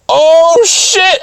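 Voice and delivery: high, loud "oh shit" reaction; stretched-out, high-pitched amazement; scandalized delight. Retold or imitated. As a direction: hoot it in mock shock. Acts own reaction to innuendo.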